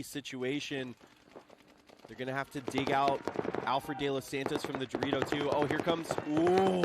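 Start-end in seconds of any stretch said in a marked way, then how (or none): 5.5-6.8 sudden rising excited call; excited outburst. Real, not acted. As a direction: react loud and high to a big play. Sudden elimination during live play.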